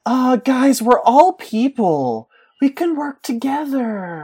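high, slow, pleading appeal; calm the room like a naive idealist; earnest peacemaker. Acted. Chaos side voiced as peacemakers.

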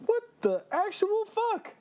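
high-pitched mock bewilderment; incredulous. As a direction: squeal it in hyped disbelief. Hyped, incredulous reaction to game boss.

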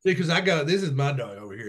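usual speaking voice

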